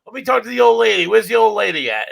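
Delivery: put-on high, fast, brash call to the old lady; mock-brash shout into the room; cocky hey there old lady; loud call. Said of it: "I'm like".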